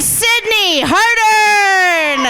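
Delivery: drawn-out stage announcement of names; rousing host shout, stretching the names; announcer. Real, not acted. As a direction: call the winners' names out to the crowd. Host announces the winners to crowd.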